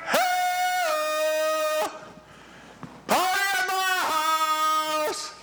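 very high, slow hollering invitation; calling out. Acted. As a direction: call the whole street to your party. Woman calling neighbours to party.

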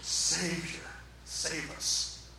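low, soft plea, barely voiced; hushed retold cry. Preacher first gives the crowd's cry softly, hushed. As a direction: report the crowd's yell under your breath. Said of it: "people all around him yelling out".

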